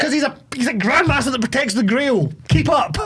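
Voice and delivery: high, impatient explaining; exasperated. Real, not acted. Exasperated answer to co-host's doubts.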